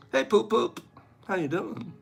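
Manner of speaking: soft, sappy pet-name voice; mock romantic. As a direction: coo silly love words.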